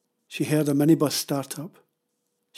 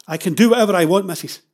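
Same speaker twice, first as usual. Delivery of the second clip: fast, loud, raised-pitch defiance; angry retort; 5 semitones higher, 11 dB louder, faster than usual. Acted. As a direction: cut in defiantly. Father interrupts protester angrily.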